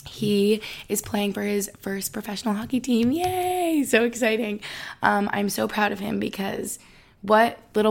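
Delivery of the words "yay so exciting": high excited "Yay!"; excited cheer. Real, not acted. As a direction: squeal it with joy. Cheering boyfriend's hockey news.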